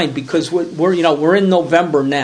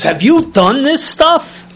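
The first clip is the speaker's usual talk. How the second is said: much higher and louder, slow disbelieving question; amazed priest; 10 semitones higher, 6 dB louder than usual. Retold or imitated. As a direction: ask it in astonishment, pitched way up. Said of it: "He goes".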